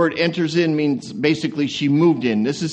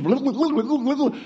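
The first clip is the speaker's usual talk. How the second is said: mock chatter; 6 semitones higher, 4 dB quieter than usual. Mimicking fast chatter.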